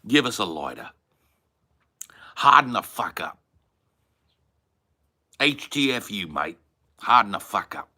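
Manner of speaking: broad Aussie slang, falling at the ends; Australian accent. Shown as a demonstration. Aussie mate saying to toughen up, Australian accent. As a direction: tell them off in broad Australian. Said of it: "a certain kind of Australian".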